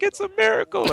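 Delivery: high squeaky mock hilarity; retold helpless amazement; imitated cracking up. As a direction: squeak it out as if dying laughing. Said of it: "Spooky just started dying".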